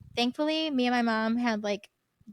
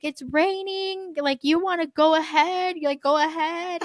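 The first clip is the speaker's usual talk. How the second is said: high, drawn-out mock wail; stretched-out, higher-pitched pleading; retold sobbing; 5 semitones higher than usual. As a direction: imitate a tearful mom giving up. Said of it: "she's like, sobbing".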